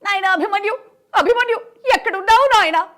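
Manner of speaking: high, loud anguished calling for a lost son; Mayabazar mother's lament. Retold or imitated. Mimic recreating the Mayabazar scene, calling Abhimanyu high and loud. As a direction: cry out for your son across the battlefield. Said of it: "Mayabazar చూసావు కదా".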